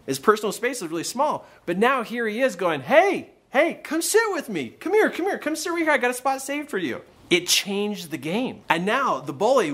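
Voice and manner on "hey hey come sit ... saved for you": very high, eager hailing; imitated boy's keen invitation; confident call-over. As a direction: call him over, voice pitched way up. Son calling bully over, retold.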